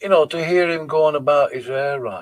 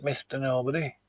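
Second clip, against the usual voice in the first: usual speaking voice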